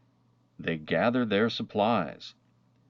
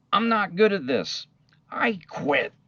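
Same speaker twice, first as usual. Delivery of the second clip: put-on much higher, louder wail of a frustrated child; upset girl quitting; 12 semitones higher, 5 dB louder than usual. The girl to her dog assistant, a high frustrated wail. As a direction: cry it out like a kid ready to give up.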